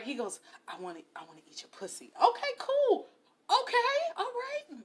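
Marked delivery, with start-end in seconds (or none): 0.7-2.1 low, very quick murmur; rushed mock-eager undertone; hushed eagerness. Acting out his eager offer. 2.2-4.8 high-pitched, tentative going-along; retold squeaky hopeful doubt; dubiously agreeable. Humouring his offer with doubt.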